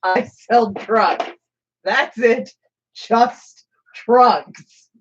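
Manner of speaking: slow, flat shopkeeper drone; slow deadpan shopkeeper. Retold or imitated. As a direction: drag out each word like a bored vendor. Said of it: "and he goes".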